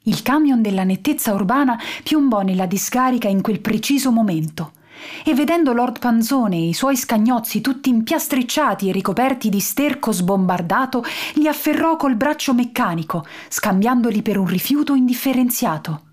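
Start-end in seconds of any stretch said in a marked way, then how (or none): none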